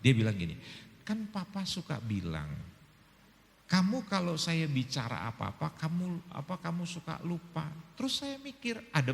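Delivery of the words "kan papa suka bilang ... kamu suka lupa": softer, mild calm explanation; gentle soft-spoken wife. Retold or imitated. Wife calmly explaining to angry husband, soft and gentle. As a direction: answer gently, never raising the voice. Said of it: "Dia nggak pernah berbicara keras gitu".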